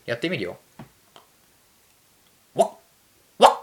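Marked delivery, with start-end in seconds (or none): 2.5-3.5 abrupt amateur dog bark; two short yaps, imitating a dog; dog impression. Shown as a demonstration. Podcaster trying a dog impression for listeners, amateur barking. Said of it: "犬のモノマネってできる？ やってみるよ".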